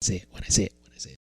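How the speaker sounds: repeating echo dying away to a whisper; fading delay echo. Shown as a demonstration. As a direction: let the words bounce and fade out. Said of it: "it would go".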